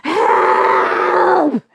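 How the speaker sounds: high, loud panicked cry for help; mimicking mum shouting for help from the water; imitated scream. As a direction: yell it like a frightened mum calling to a boat. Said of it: "just screams".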